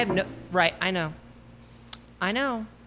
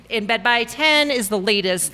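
ordinary talk

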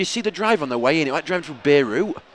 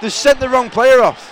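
outraged shout; 8 semitones higher, 6 dB louder, slower than usual. Real, not acted. Wrong player sent off.

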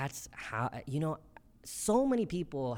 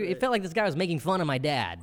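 ordinary delivery

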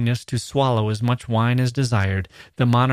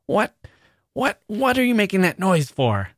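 furious, much higher demand; 9 semitones higher than usual. Acted. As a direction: snap at him furiously. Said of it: "demanded the king, turning furiously".

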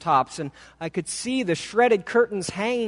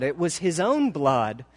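ordinary delivery, nothing marked

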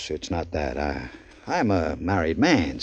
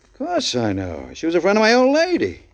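much higher, startled disbelief; jolted by the word murderer; shocked alarm; 6 semitones higher than usual. Acted.

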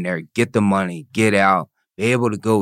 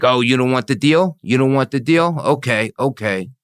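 higher, louder taunting repeat; mocking prosecutor; 6 semitones higher, 3 dB louder than usual. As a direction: toss it back at him like a smug prosecutor. Quoting a smug prosecutor.

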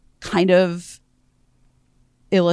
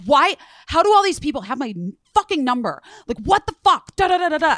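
high-pitched mock rant; worked-up, indignant, pitched well up; imitated screaming; 9 semitones higher than usual. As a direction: imitate an irate caller ranting. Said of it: "screaming at me".

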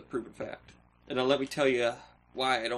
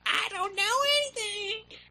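very high squeaky demo voice; silly high voice; 4 dB louder than usual. Shown as a demonstration. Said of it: "I can just start saying".

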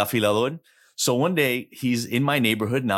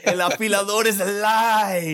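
high, long-held mock cry; mock proclamation; 9 semitones higher than usual. Host busts the neighborhood myth, high mock proclamation. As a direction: proclaim it high like a scandalous revelation.

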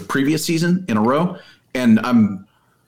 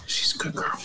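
soft whisper to a dog; 7 dB quieter than usual. Real, not acted. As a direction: coo it under your breath. Cooing to the dog.

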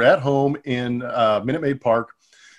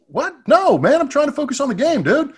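exasperated protest; 14 semitones higher than usual. Retold or imitated. Teammate pesters him mid-game, high exasperated protest. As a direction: wave him off, wound up.

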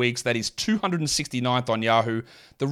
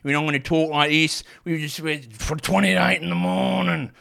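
full Aussie accent; 4 semitones higher than usual. Shown as a demonstration. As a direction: lay the broad Aussie accent on thick. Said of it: "Imagine I really talked with a full Aussie accent".

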